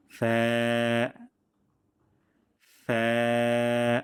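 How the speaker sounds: long, light ফ্যা with fronted ae; thin fa. Shown as a demonstration. Teacher modelling for practice, long thin ফ্যা. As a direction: hold ফ্যা thin and even. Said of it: "পাতলা সাউন্ডে".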